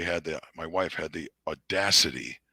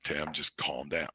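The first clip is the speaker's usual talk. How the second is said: wife soothing; 4 semitones lower, 9 dB quieter, slower than usual. Retold or imitated. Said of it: "she's like".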